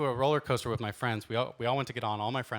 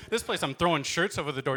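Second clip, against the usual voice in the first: normal talking voice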